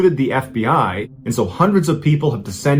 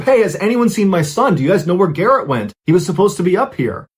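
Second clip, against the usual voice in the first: higher-pitched, mildly panicked mock yell; retold panicked call; 6 semitones higher than usual. Father calls to the group for his missing son, retold panicked call. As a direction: call out in rising worry, without a full shout. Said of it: "starting to panic and he yells out".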